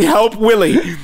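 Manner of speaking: usual speaking voice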